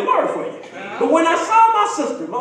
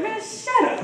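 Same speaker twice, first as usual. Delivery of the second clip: higher, scornful brush-off in a put-on voice; mock dismissive king; 9 semitones higher, 5 dB quieter than usual. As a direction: wave him off again with contempt. King Ahab dismissing the prophet.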